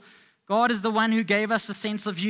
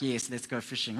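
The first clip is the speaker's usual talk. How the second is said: quiet mock agreement; 10 semitones lower, 8 dB quieter than usual. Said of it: "other disciples are like".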